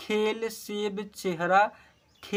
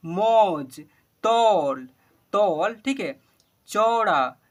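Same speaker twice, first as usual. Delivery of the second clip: each word called out louder and clear, one by one; loud word-list reading; 4 dB louder, much slower than usual. Shown as a demonstration. Teacher calling out Hindi words to pupils, loud and clear. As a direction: read every word out loud for the class to repeat.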